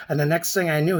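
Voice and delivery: ordinary delivery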